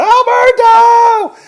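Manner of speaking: long, drawn-out high scream of a name; full-force high yell, stretched out; frantic yelling. Retold or imitated. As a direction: imitate a man bellowing wildly for someone lost. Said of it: "he starts yelling".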